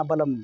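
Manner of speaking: "बलम" as two syllables with L voiced; L sounded. Shown as a demonstration. Teacher jokes about the misreading, L sounded in "बलम". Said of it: "इसको बलम मत पढ़ना".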